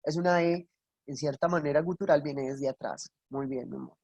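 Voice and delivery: normal talking voice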